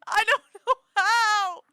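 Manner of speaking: high, loud and slow laughing cry; helpless laughing shout; laughing wail. Real, not acted.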